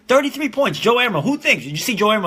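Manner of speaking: high excited bragging; excited hype. Real, not acted. Bragging about Joe's game to the stream, excited. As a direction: keep hyping him up. Hyping his friend's game.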